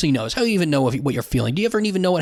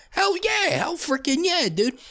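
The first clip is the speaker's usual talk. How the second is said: much higher, hyped-up cheer; hell yeah cheer; 13 semitones higher than usual. Acted. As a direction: whoop it up like a frat bro celebrating. Cheering about beers with the boys.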